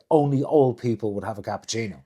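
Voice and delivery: usual speaking voice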